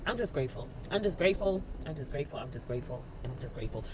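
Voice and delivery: fading to a low, soft murmur; hushed gratitude. Real, not acted. Repeats thanks after crying.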